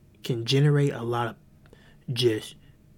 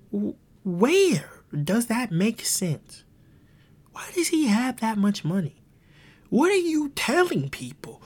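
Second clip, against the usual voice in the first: incredulous rant; 9 semitones higher, 4 dB louder, slower than usual. Real, not acted. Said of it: "ranting at rich preachers".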